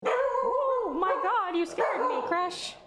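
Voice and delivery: high startled squeal; jolted by a sudden noise; jump scare laugh. Real, not acted. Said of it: "Holy shit".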